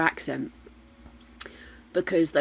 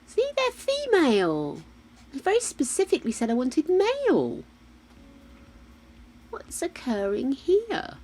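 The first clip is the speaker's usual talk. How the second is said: indignant high voice; 9 semitones higher than usual. Real, not acted. Annoyed at game.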